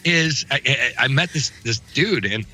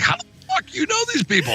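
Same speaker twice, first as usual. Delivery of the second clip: much higher, stunned and amazed; high incredulous disbelief. Retold or imitated. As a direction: play it like you can't believe it. Retold friend's disbelief.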